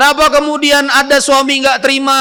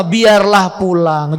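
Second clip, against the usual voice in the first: very slow, spaced phrase; slow for repeating; 3 semitones lower, much slower than usual. Shown as a demonstration. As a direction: say it slowly so all can repeat. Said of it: "Coba sama-sama".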